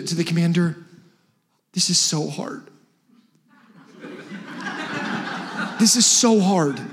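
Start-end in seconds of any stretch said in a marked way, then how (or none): 1.7-6.8 mock whining. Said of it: "do they say to the commander".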